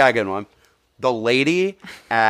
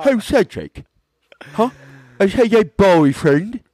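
playful mock British taunt, much higher; mock British accent; 10 semitones higher, 4 dB louder than usual. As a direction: tease in a hammed-up British accent. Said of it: "when you do this accent".

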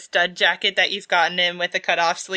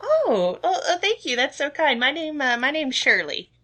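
high, delighted cashier; flattered small-town clerk; shopkeeper voice; 7 semitones higher than usual. Acted. GM voicing Shirley.

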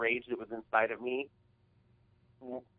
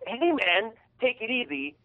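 mock placating; 9 semitones higher than usual. Truck owner telling angry guy to calm down, mock placating. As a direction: imitate the guy calming him down. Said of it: "he goes".

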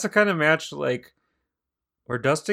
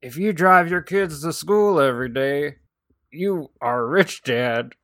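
a hint of a Southern comic's setup, louder; Jeff Foxworthy bit; 4 semitones higher, 4 dB louder than usual. Podcaster riffing on a Foxworthy-style joke, lightly louder. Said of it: "like a Jeff Foxworthy bit".

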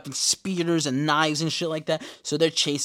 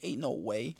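softer, stunned "Ain't no way"; stunned murmur; 2 semitones lower, 8 dB quieter than usual. Retold or imitated. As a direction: breathe out "Ain't no way" quietly. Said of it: "I was like".

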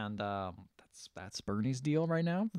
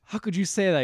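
higher, mock-aghast reaction; retold shock; 7 semitones higher, 4 dB louder than usual. Woman reacting to his coming out, retold shock. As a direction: act out a scandalised reply.

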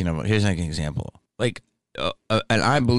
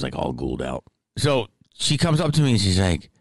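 usual speaking voice

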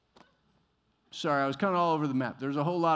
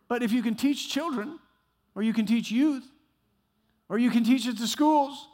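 loud, ringing pulpit delivery; full-force preaching; 11 semitones higher, 9 dB louder than usual. Acted. Pastor preaching to congregation about exhortation, loud and fervent. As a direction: preach it hard to the whole room.

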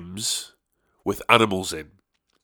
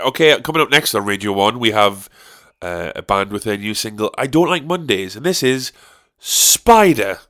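fast, louder DJ patter; mock radio DJ; 3 dB louder than usual. A fake Radio One announcement, mock DJ. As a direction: hype it like a radio host.

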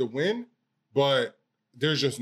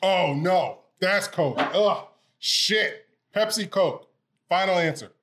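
excited; 5 semitones higher, 4 dB louder, slower than usual. Real, not acted. Blind taste test reaction.

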